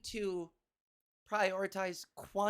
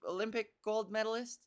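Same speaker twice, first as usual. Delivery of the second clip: ordinary talk, nothing marked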